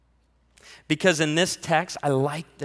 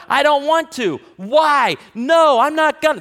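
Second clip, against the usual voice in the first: put-on much higher and louder child refusal; whiny defiant kid; 10 semitones higher, 9 dB louder, slower than usual. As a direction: whine back like a stubborn kid. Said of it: "Active resistance is the".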